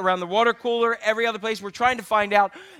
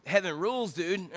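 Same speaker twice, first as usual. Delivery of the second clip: laid-back valley voice, softer; 2 semitones lower, 8 dB quieter than usual. Shown as a demonstration. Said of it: "Say it, like, with a valley voice".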